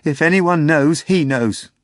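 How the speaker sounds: high, loud imploring plea; passionate pleading. A narrator's reading. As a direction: insist with fervent conviction. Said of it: "implored passionately".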